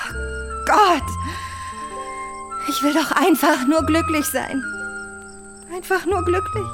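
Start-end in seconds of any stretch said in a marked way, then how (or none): 0.1-1.0 higher-pitched, anguished outcry; anguished cry. Acted. Anguished monologue about her dreams. 5.7-6.6 higher-pitched, wistful echo; wistful longing. Acted. Wistful repeat of her wish.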